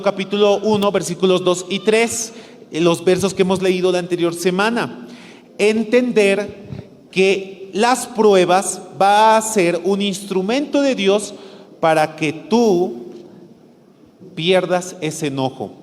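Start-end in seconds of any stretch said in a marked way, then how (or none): 5.6-15.7 predicación pausada. Shown as a demonstration. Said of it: "predicación pausada".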